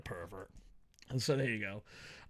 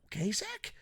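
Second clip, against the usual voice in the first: soft, raised questioning pitch; pointed soft check; 6 semitones higher, 8 dB quieter than usual. Acted. Reviewer scolding Zack Sabre Junior, pointed soft check.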